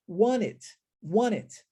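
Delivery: "Want it" fused into "wan-it"; dropped T. Shown as a demonstration. Teacher repeats the fused form for students, T dropped. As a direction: link "Want it" with the first T left out. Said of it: "omitirmos o som do T".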